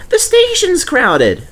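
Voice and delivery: high, eager little engine voice; Percy's voice. Acted. Percy reminding Douglas, in a high eager voice. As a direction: play the small cheeky tank engine.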